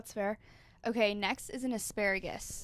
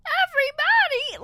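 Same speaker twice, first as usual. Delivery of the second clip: very high, loud squeaky cartoon shout; high cartoon veggie call; little asparagus character; 11 dB louder than usual. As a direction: yelp it like a nervous cartoon veggie kid. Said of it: "the voice of ... on VeggieTales Junior".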